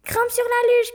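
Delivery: high, loud urgent command; panicked high shout; shouted order. Acted. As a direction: yell at her to climb on. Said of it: "cria Tim".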